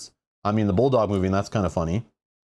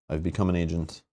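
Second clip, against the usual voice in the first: mock robot agent; 5 semitones lower, 4 dB quieter than usual. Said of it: "in the role of a bot".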